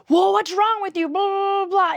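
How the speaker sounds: high, put-on mocking voice of a scolding commenter; mocking critic. Vlogger mocks a commenter scolding her imperfection, high squeaky mock outrage. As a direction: squeal it like a shocked, nagging critic. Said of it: "is like a".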